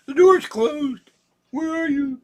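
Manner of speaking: very high and loud, a squeaky worried little cat. Pretend. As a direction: squeak it out as an anxious kitty. Voicing the cat's worry.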